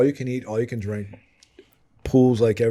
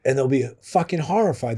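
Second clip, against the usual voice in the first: ordinary delivery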